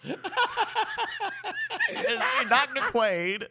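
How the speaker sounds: hammed-up impression. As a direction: announce yourself as the TV doctor. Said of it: "is that your impression?".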